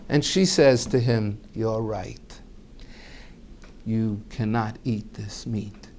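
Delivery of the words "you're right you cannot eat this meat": calm soft reply. Retold or imitated. Wife calm reveal.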